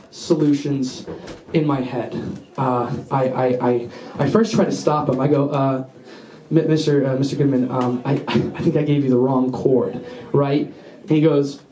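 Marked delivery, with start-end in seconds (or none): 5.5-10.7 low, halting, acted stammer; hesitant stalling. Student trying to stop his teacher, hesitant stalling. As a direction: stumble sheepishly through a weak excuse.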